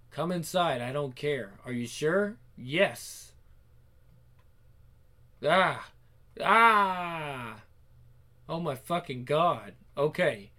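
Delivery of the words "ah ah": pleasure moan. Acted. Climax in intimate scene.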